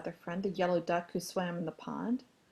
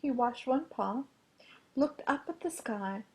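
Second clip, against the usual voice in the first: plain reading voice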